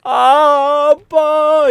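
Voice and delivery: high, very drawn-out mock wail; hammed-up tearful first word; crying zombie daughter. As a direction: wail for dad as a zombie child. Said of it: "좀비인데 막 울어".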